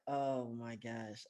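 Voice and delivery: soft, slow, low groan; weary dismay. Retold or imitated. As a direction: sigh it out under your breath.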